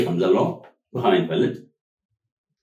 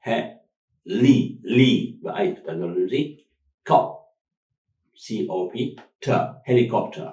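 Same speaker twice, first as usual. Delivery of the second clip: he- li- cop- ter broken up slowly; split into syllables; slower than usual. Shown as a demonstration. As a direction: chop helicopter into beats. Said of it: "One, two, three, four".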